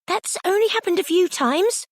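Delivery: high desperate squeak. A narrator's reading. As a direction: squeak it out in protest. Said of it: "squeaked desperately".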